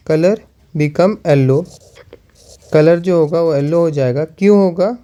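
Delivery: slow word-by-word dictation, each word repeated; slow, halting classroom dictation with long pauses. Shown as a demonstration. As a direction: dictate it slowly so the class can copy it down. Teacher dictates observation while writing.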